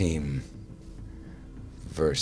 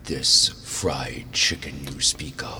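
otherworldly tone. Acted. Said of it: "kind of an otherworldly tone".

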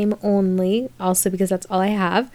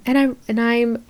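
ordinary talk

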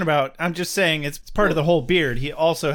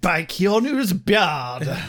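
mock oath voice; much slower than usual. Mock oath, slow put-on voice.

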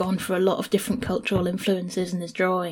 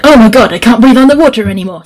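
much louder and fast, panicking; panicked gasp; 3 semitones higher, 15 dB louder, faster than usual. Retold or imitated. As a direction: blurt it out as she realises she's drowning. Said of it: "and she's like".

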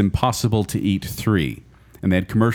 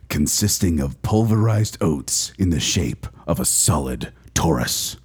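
slow, low, epic announcer read; movie trailer voice; 5 semitones lower, slower than usual. Acted. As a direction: narrate it like a blockbuster trailer. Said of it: "Can I hear this in the movie voice".

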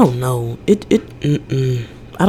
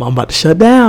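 panicky inner voice; 3 semitones higher, 4 dB louder, faster than usual. Retold or imitated. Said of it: "I can feel myself being like".

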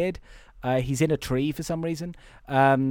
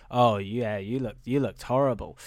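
low, dry imitation of sarcasm; mock agreement, pitched low; sarcastic; 5 semitones lower than usual. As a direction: quote it with understated sarcasm. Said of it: "uh, sarcastically".

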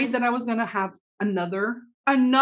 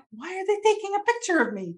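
high, fast alarmed question; alarmed disbelief; 11 semitones higher, much faster than usual. Retold or imitated. To herself as a stranger photographs her, high and fast alarm. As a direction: blurt it out, suddenly high and rushed.

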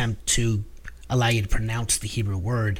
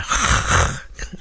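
long voiceless Hebrew ch; drawn-out hard throaty rasp, as if clearing the throat; throat-clearing guttural. Shown as a demonstration. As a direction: scrape the back of the throat without voice. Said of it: "that hard".

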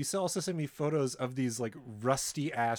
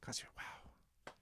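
whisper; 18 dB quieter, much slower than usual. Real, not acted. Hushed reaction to the cost.

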